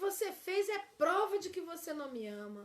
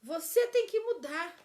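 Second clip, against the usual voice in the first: loud, higher blaming command; accusing demand; 4 semitones higher, 8 dB louder than usual. Shown as a demonstration. Teacher shows the blaming spouse, loud demand. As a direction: bark it at your partner. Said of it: "Aqui diz assim, ó".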